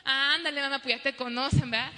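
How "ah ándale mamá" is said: put-on louder whiny kid plea; whining child. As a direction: nag mom like a spoiled kid. (niño rogando a mamá con tono quejumbroso)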